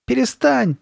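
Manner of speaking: put-on high, scared and cross little-boy yell; high-pitched angry protest; angry cry. A narrator's reading. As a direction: shout it crossly with fear underneath. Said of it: "закричал Ноа".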